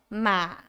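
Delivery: MA dipping, then rising; low dipping tone on MA; third tone. Shown as a demonstration. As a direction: take "ma" down and back up. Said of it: "you go down and go up".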